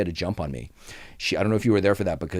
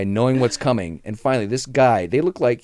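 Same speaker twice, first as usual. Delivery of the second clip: ordinary talk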